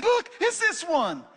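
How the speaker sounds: high, slow, eager plea; excited pastor's wife. Retold or imitated. Pastor's wife insisting on her stone, high and excited. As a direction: draw it out, thrilled as a child. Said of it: "and she said".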